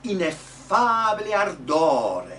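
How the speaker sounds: loud, slow "ineffabile ardore" in one line; teacher projecting "ineffabile ardore" for echo; model Italian phrase. Shown as a demonstration. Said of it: "The two words together".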